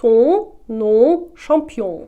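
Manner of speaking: Ton, nom, champion with heavily nasal final vowels; loud, spaced models of Ton, nom, champion, strongly nasalised. Shown as a demonstration. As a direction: let Ton, nom, champion resonate in the nose, no N or M. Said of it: "дуже сильна носова вимова".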